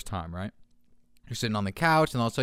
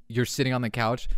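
ordinary delivery

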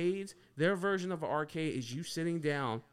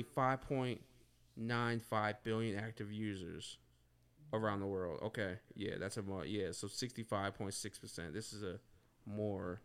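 trailing off while reading ahead; 8 semitones lower, 7 dB quieter than usual. Real, not acted. Reading stats off phone.

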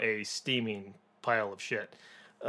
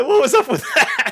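high, loud, laughing disbelief; baffled laughing squeal; 9 dB louder than usual. Retold or imitated. Reviewer imitating the kid's reaction, high laughing squeal.

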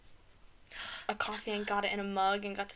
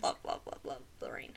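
whispering; 10 dB quieter than usual. Real, not acted. Whispering love of rain.

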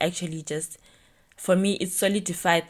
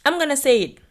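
emphatic declaration; 9 semitones higher, 8 dB louder, faster than usual. Real, not acted. Declaring she'll speak up.

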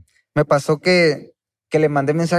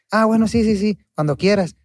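higher, overly eager fake agreement; fake agreeable; 8 semitones higher than usual. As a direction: pretend to go along, much higher. Mock reply to a flaky artist.

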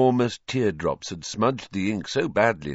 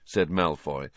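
usual speaking voice

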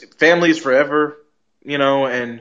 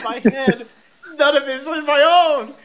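crying and moaning; 9 semitones higher than usual. Retold or imitated. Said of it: "sounded like Paul Bearer with all the crying and moaning".

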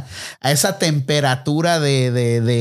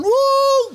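party whoop. Retold or imitated. Friend whooping on the dance floor, high yelp.

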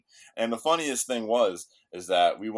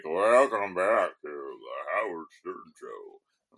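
Howard Stern impression; 3 semitones lower, much slower than usual. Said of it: "I wanna be Howard Stern".

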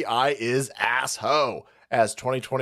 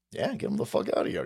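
normal talking voice

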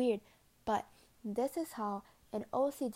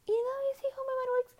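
very high, fast and louder silly voice; squeaky cartoon voice; 7 dB louder, much faster than usual. Repeating the question to viewers in a fast, very high, loud silly voice. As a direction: rattle it off like a chipmunk.

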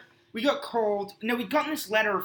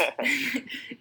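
high burst of laughter; 5 semitones higher than usual. Real, not acted. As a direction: let a real laugh escape. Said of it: "oh, it gets worse".

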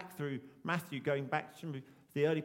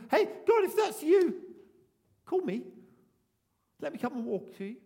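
much higher, loud shouted dare to God; bold call; 8 semitones higher, 10 dB louder than usual. Pretend. Imagined bold disciple shouting to God from the boat. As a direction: fling it out across the water.

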